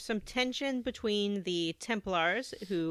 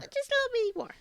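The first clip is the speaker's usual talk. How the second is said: much higher, sweetly innocent; high cutesy voice; much faster than usual. Retold or imitated. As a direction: coo it like a harmless treat. Echoing high Patrice voice.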